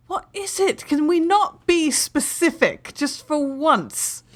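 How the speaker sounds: high-pitched, loud, drawn-out exasperated outburst. Acted. Player voices her character reacting to a vague message, exasperated. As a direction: act out being fed up, pitch way up. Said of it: "I'm gonna be like".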